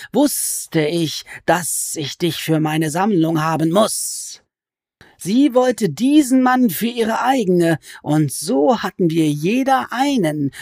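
high, slow, wheedling creature voice that climbs on each nein; sly goblin voice. Acted. Goblin crouched over Alice, complaining she is a sorceress, in a high sly voice. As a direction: stretch the words like a gloating, sulky goblin.

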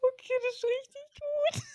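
laughing through the words. Real, not acted. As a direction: speak while cracking up. Laughing at the quote.

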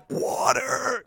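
drawn-out, strained croak; hammed-up thirsty rasp; parched plea. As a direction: act out being desperate for a drink. Acting out thirst in the heat.

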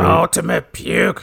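slow, gravelly mock promo voice; Sergeant Slaughter. Podcaster repeating the wrestler's insult, slow gravelly impression. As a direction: draw out the insult like a growling wrestler. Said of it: "he called the Ultimate Warrior".

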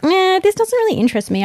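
bored dismissive quote. Retold or imitated. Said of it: "I was like".

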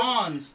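"Ons" alone, as in turn it on; syllable demonstration. Shown as a demonstration. Teaching friend the second syllable, "Ons" set apart. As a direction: isolate "Ons" with an open vowel. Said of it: "Turn it ons".

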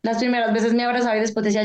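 ordinary delivery

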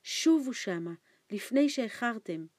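loud, high, frightened plea; urgent warning. A narrator's reading. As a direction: urge him to go back before it's too late. Old man urgently warns boy.

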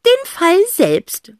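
high, loud, slow final words; determined aunt. A narrator's reading. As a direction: declare it like the plucky aunt. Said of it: "ergänzt Tante Rotz".

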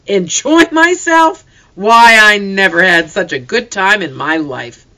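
happy father telling his wife; near shout. A narrator's reading. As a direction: nearly shout with delight. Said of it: "Papa almost shouted".

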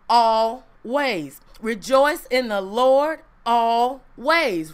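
slow, weighty reading of the verse; solemn scripture reading. Acted. Preacher to congregation, slow solemn verse reading. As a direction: lean into each word of scripture.

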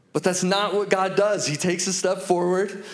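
ordinary delivery

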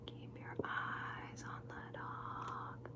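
barely audible, drawn-out whisper; slow faint whisper. Acted. Repeating the instruction to the listener, faint slow whisper. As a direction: breathe each word out very slowly without voice.

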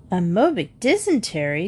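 questioning repeat with a swooping rise on DYSENTERY; incredulous echo. Acted. Mrs Jones questions Julie's odd diagnosis, incredulous echo. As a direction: echo it back as a disbelieving question. Mother echoes it in disbelief.

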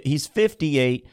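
ordinary talk

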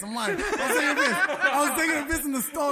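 laughing through the words; giggling while telling it. Real, not acted. Laughing at own story.

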